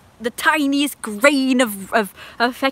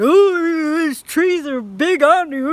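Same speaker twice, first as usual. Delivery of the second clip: higher, louder mock neighbour voice; imitating the neighbour; 6 semitones higher, 5 dB louder, slower than usual. Neighbour going on about the trees, in a higher mock voice. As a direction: ham up the nosy neighbour. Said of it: "He started going".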